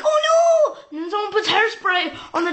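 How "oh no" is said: high, loud cry of alarm; mock alarm. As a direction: yelp as if it's about to go wrong. People see water dripping near straighteners.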